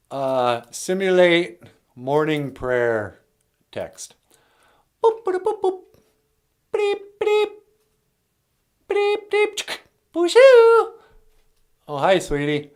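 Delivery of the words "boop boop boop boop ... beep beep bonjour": high electronic boops and beeps; computer beeps. Pretend. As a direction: beep like a talking machine. Computer beeping noises.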